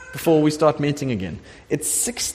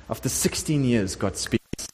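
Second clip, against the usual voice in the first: normal talking voice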